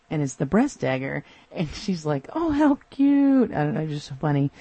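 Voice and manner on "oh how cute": high, slow gushing coo; high slow delighted coo; melting over cute. Retold or imitated. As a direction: melt over something adorable. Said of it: "she just completely melts".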